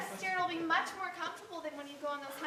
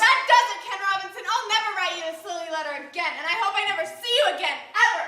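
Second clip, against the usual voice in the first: loud, final, outraged declaration; furious ultimatum; 10 dB louder than usual. Acted. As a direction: explode and end it for good. Acted quarrel, she breaks it off.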